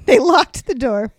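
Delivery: ordinary talk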